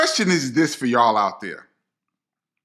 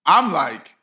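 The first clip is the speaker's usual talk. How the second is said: plain reading voice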